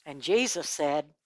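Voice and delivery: plain reading voice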